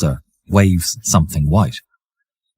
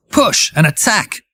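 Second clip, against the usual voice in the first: raised pitch, narrated battle command; barked order; 10 semitones higher than usual. A narrator's reading. Officer runs for his tanks, barking orders. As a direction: punch it out high like an order. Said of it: "barking orders to".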